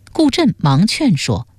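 usual speaking voice

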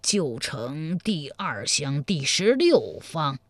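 put-on slow old commoner's reply in a changed voice; old man. A narrator's reading. Old man telling the emperor his address, humbled. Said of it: "刘老汉回道".

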